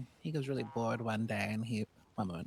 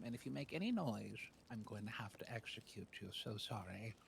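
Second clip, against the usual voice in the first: dropping to a soft, calm threat; quiet king's threat; 12 dB quieter than usual. Retold or imitated. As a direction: menace them gently and quietly. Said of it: "the king says".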